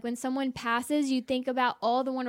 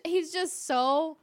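ordinary talk, nothing marked

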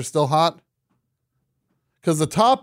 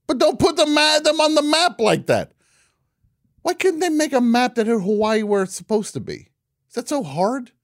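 indignant; 9 semitones higher than usual. Acted. Mock outrage at map makers.

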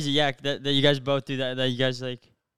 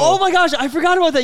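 much higher and louder, suddenly remembering; sudden realization; 12 semitones higher, 8 dB louder than usual. Real, not acted. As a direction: blurt it out as it comes back to you.

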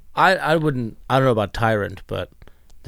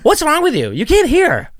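much higher and louder mock exasperation; imitating an annoyed roommate; 8 dB louder than usual. Guest mimics host snapping at him, high loud mock exasperation. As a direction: squawk it like a fed-up spouse who can't be heard. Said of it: "You're like".

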